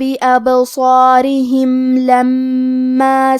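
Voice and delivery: slow, drawn-out melodic tilawa; Quranic recitation. Acted. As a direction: recite the whole verse slowly and evenly. Teacher recites the full verse.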